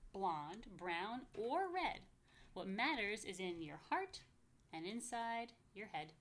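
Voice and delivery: sing-song rhyming read-aloud, slow on the lists; lively picture-book reading; reading to children. Acted. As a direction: bounce the rhymes for little listeners.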